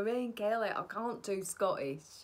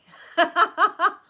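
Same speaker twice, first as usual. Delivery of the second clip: loud laugh; 11 semitones higher, 15 dB louder than usual. Real, not acted. Laughing at herself for being early, loud laugh.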